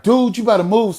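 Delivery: retold loud protest, pitched high; talking loud. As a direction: raise your voice as in a heated standoff. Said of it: "talking loud".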